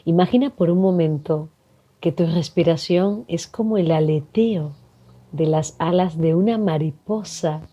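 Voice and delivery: calm meditation voice with long pauses; guided meditation. Acted. Facilitator guiding listeners to breathe and relax, calm meditation delivery. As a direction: guide them gently into relaxation, leaving space to breathe.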